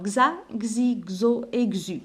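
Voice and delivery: "xa, xi, xo et xu" each said gz, slowly; sounding out syllables. Shown as a demonstration. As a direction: drag each syllable out for the class. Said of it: "la bonne intonation". (نطق "xa, xi, xo et xu" ببطء بصوت gz)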